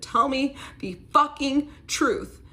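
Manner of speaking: high, slow, loud scream of the demand; retold scream. Screaming at her husband to confess, loud and slow. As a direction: yell it right in his face, dragging it out. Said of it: "I scream in his face".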